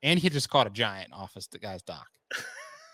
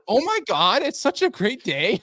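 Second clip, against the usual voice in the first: much higher and louder, slow, overdone cheer; mock peppy gush; 11 semitones higher, 6 dB louder than usual. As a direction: gush fake delight at the owner. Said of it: "super over, like, peppy".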